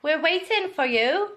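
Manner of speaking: put-on Welsh accent. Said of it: "impressed with your Welsh accent".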